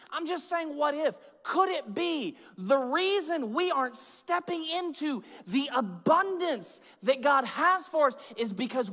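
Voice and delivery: high, insistent preacher voice; impassioned preaching. Acted. Preaching at full force.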